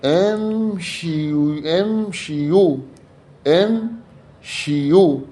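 very slow, high امشيو, split "im-shii-yuu"; kasra on shin. Shown as a demonstration. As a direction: stretch each syllable of امشيو for the class. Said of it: "بكسر الش".

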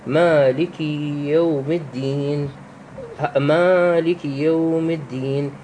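slow recitation, "aa" of مالك held long; elongated vowel. Shown as a demonstration. Teacher reciting from al-Fatiha, slow and long "aa" in مالك. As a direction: stretch the alif in مالك for two beats. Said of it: "هاي تمد بمقدار حركتين".

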